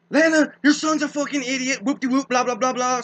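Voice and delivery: imitating an angry relative yelling; retold yelling. Relative yelling at his mom about her son, a mock yell. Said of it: "something yelling, screaming".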